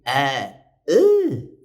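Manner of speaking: short vowel sliding up high; quick upward vocal glide; singer's vocal sweep. Shown as a demonstration. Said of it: "não adianta fazer".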